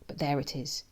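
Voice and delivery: ordinary delivery, nothing marked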